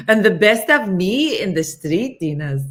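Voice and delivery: loud, lilting impression; loud mimicry with an exaggerated tune; Jurgen. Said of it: "That was Jurgen".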